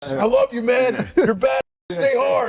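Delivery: high, intense, half-laughing hype voice; David Goggins impression. Goggins on the phone, ending on stay hard, high and intense. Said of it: "I can do him".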